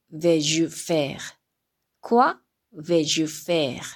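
"Quoi vais-je faire?" spoken slowly, falling at the end; slow French model reading. Shown as a demonstration. Teacher reads the inverted question slowly for students. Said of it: "次が".

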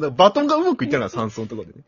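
usual speaking voice

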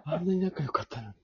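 high-pitched mock-tearful lament; 悲しむ真似. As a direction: wail it in pretend heartbreak. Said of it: "胸が締め付けられるように悲しかった". (泣きそうな高い声で嘆いてみせる)

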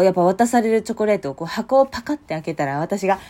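ordinary talk, nothing marked

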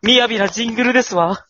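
high, loud ojousama voice; haughty rich girl japanese. Pretend. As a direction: play a posh young lady, loftily. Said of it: "お嬢様言葉を持ってこないで".

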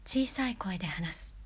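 soft unvoiced whisper; whispered demo. Shown as a demonstration. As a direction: breathe it out in a whisper. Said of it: "強弱".

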